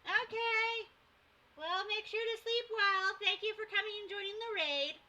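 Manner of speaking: sing-song goodbye up high; put-on voice. Streamer thanking raiders in a put-on high voice. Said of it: "avoid speaking my regular voice".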